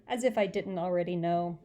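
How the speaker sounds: ordinary delivery, nothing marked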